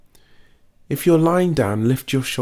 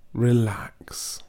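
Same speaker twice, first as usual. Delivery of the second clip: whispered relax. Shown as a demonstration. Guide whispering Relax for the listener to hold in mind. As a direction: breathe the word Relax like a thought. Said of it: "In your mind, focus on it".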